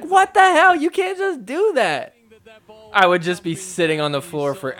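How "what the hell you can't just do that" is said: very high, loud protest; mock outraged opponent. Commentator voicing the opponent who saw the early celebration, mock outrage. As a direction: imitate a player squawking in disbelief.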